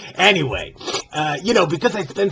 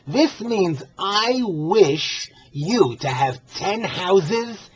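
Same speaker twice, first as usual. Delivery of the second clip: ordinary talk, nothing marked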